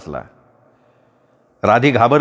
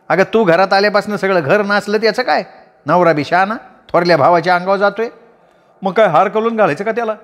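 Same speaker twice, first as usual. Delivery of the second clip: higher woman's voice, fast angry taunting; Radhi's tirade; 6 semitones higher than usual. A narrator's reading. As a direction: rattle off the insults. (भांडकुदळ बाईचा तिखट आवाज)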